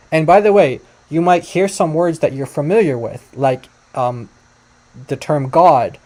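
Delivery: normal talking voice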